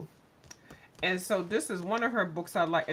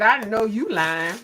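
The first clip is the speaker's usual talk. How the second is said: skeptical jab; 7 dB louder than usual. Retold or imitated. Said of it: "I said".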